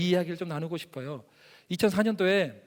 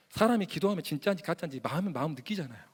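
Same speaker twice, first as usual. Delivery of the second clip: normal talking voice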